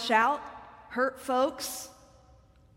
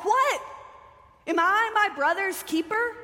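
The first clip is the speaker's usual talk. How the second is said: loud, higher outcry of a defiant man; crying out; 8 semitones higher, 4 dB louder than usual. Acted. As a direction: Cain shouting back at God, defiant. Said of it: "And he cries out".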